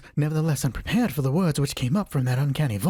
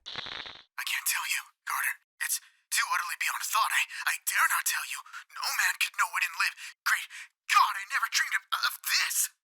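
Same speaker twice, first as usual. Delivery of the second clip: too afraid to speak aloud; hoarse with fear; 5 semitones higher, 5 dB quieter than usual. A narrator's reading. Warren telling Carter from the vault, a despairing hushed whisper. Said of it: "still hoarse with fear, and now apparently tinged with despair".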